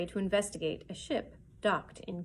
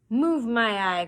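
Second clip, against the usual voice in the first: louder scornful snap; angry cry; 3 semitones higher, 8 dB louder, slower than usual. Acted. Pew refusing to leave, angry cry. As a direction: scoff at the retreat. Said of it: "cried Pew".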